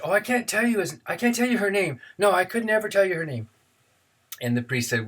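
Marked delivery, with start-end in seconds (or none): 0.0-3.5 put-on higher-pitched boy pleading to keep a secret; protesting teenage boy. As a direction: play the reluctant boy, pitched up. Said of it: "And the boy said".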